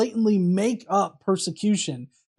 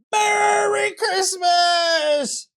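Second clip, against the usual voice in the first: drawn-out, higher, triumphant holiday shout; shouted greeting; 8 semitones higher, much slower than usual. Acted. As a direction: belt it out like a rallying cry. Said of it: "And then they shouted".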